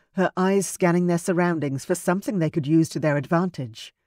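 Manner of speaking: usual speaking voice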